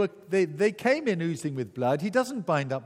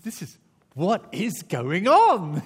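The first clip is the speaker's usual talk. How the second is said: baffled; 4 semitones higher, 8 dB louder, slower than usual. Retold or imitated. Prisoners puzzled by singing.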